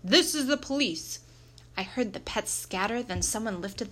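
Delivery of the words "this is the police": high, loud police announcement; shouting. A narrator's reading. Police rushing into the school, shouting. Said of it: "people came rushing in shouting".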